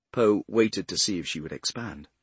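ordinary delivery, nothing marked

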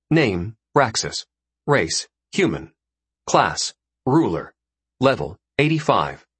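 slow, even stat-sheet read; status readout. A narrator's reading. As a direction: read it out like a game menu. Reading character status screen.

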